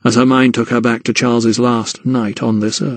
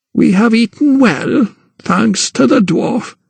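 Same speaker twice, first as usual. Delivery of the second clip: put-on slow, much higher old gran, calm; calm old woman; 10 semitones higher, slower than usual. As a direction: admit it calmly, no fuss. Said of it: "she said calmly".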